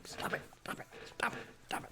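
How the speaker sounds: a whispered, imitated panicked stop it; mock whispered plea. Retelling being hit by the fan, mock whispered plea. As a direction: hiss the stop it repeatedly under breath. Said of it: "ceiling fan goes like".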